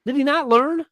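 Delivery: high-pitched incredulous questioning; exasperated disbelief. Retold or imitated. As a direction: retell your exasperated reaction, pitch climbing sharply. Retelling own exasperated reaction.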